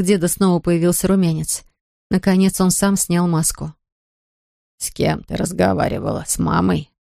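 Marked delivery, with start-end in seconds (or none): 4.8-6.9 put-on a tired old man's question; weak old man. A narrator's reading. Weak old grandfather speaks.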